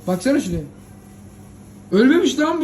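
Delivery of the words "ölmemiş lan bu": shocked. Acted. Friends discover the dead moderator alive.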